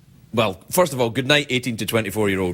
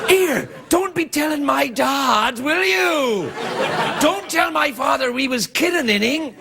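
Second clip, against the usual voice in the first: high, slow shouted cockney plea; cockney maid; 12 semitones higher, slower than usual. Acted. Maid begs Lord Percy not to tell her dad, high shouted cockney. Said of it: "posh English".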